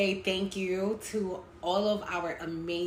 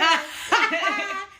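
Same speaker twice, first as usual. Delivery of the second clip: high laugh; 13 semitones higher, 7 dB louder than usual. Real, not acted. Laughing at friend.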